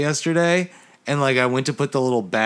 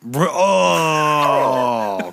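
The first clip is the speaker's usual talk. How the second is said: high, loud grossed-out groan; disgusted; 3 semitones higher, 4 dB louder than usual. Real, not acted. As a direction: recoil in disgust. Grossed out by the topic.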